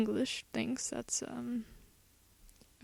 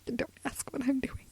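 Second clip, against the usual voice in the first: whispering; 7 dB quieter than usual. Real, not acted. Aside while fumbling.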